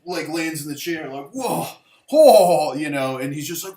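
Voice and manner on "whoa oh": loud, higher mock gasp of shock; astonished whoa. Man landing back in the chair after tripping out, mock astonished. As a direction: imitate someone reeling as he lands.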